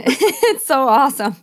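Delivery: laughing through the words; giggly and delighted. Real, not acted. Laughing reaction.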